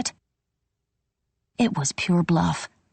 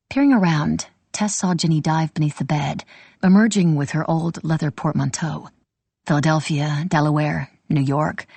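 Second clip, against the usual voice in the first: ordinary delivery, nothing marked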